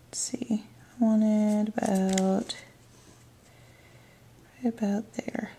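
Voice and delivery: slow, unvoiced murmur while concentrating; whispered aside. Real, not acted. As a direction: whisper to herself while lining up the strip. Muttering to herself while placing strip.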